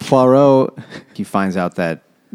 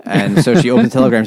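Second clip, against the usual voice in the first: usual speaking voice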